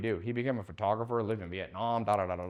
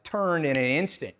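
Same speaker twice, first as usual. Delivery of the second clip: ordinary talk, nothing marked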